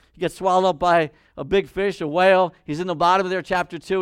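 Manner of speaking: normal talking voice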